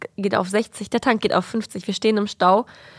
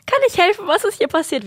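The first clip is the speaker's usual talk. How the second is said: lightly voiced friendly neighbor, higher; a hint of an eager helper, pitched up; helpful neighbor; 8 semitones higher than usual. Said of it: "er meinte so". (leicht gespielter hilfsbereiter Nachbar, höher)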